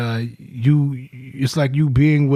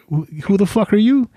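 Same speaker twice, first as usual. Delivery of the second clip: higher, quick incredulous challenge; mock challenge; 7 semitones higher, faster than usual. New unit guys confronting a returning sergeant, incredulous. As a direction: fire it off like a cocky new guy sizing him up.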